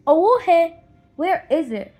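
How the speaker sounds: loud, busy mother calling back; mother shouting back from her work; mother's loud reply. Acted. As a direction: answer the child without looking up. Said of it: "her mother asks".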